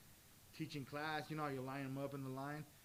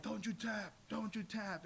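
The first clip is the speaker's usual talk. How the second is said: imitated coach urging; 7 semitones higher, slower than usual. Imitating coach urging him mid-match.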